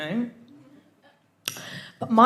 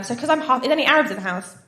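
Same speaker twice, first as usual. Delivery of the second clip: ordinary talk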